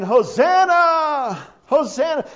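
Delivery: loud, high cry with the first word drawn out; jubilant crying out. Retold or imitated. Preacher voicing children praising Jesus, loud high calling. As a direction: call it out like a praising crowd. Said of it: "all the children are walking around saying".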